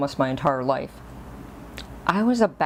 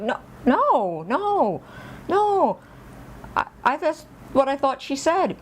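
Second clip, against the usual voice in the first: rising, ever higher "no"s; imitated protest; 10 semitones higher, slower than usual. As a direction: protest your innocence, climbing in pitch. Child protesting a misunderstanding, retold.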